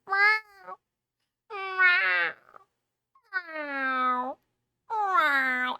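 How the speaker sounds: high, loud, cat-like meowing; imitating cat meows. Streamer mimics her cat meowing outside her door, high cat meows. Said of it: "I start hearing her going".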